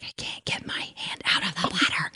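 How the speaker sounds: panicked hushed whisper to the surgeon. Retold or imitated. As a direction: whisper it so the owner can't hear. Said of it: "whispering to her".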